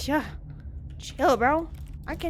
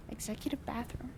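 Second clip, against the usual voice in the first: whisper; 2 semitones lower, 12 dB quieter than usual. Acted. Reading a sign in whisper.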